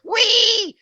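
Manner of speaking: put-on a kid squealing wee to disrupt the chant; squealed wee. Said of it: "so it's like".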